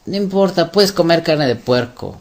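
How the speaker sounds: high-pitched mock permissiveness, easing down; airily imitating others' permission; mock carefree quote. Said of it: "como las naciones que dicen".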